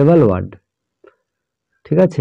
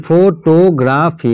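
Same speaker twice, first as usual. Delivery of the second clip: Photography in four slow beats, ফো, টো, গ্রা, ফি; syllable by syllable; 3 semitones higher, much slower than usual. Shown as a demonstration. Teacher shows four syllables again, photography split as ফো-টো-গ্রা-ফি. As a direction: sound out every syllable of photography. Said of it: "এখানেও চারটে".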